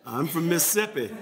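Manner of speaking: Mississippi local accent, swallowing a syllable of Mississippi; Southern insider's squeezed Mississippi. Shown as a demonstration. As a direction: say Mississippi like a Southern native, as Miss-sippi. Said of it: "they say Mississippi".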